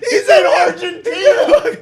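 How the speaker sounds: high, slow laughing wail; laughing shout. Pretend. Comedian revealing the fugitive's hideout, slow laughing wail.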